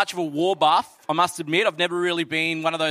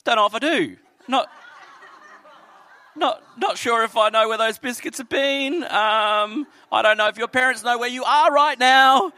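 higher, louder awkward voice; hesitant mock adult; 5 semitones higher, 4 dB louder than usual. Pastor refusing the girl's biscuits, awkward. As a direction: play the suspicious grown-up. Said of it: "And I'm like".

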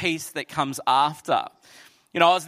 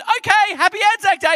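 put-on much higher, louder chirpy child; little girl voice; 14 semitones higher, 7 dB louder than usual. Little girl wishing happy Anzac Day, squeaky high. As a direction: imitate the girl cheerily. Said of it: "And then she went".

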